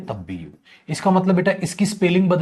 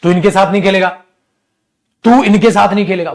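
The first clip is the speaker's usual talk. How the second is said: put-on loud, higher scolding of a child; scolding parent; 5 semitones higher, 10 dB louder than usual. Parent forbidding the child to play, loud scolding. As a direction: scold the kid sharply, voice raised. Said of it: "mummy papa डांटते ना ... डांटते हैं".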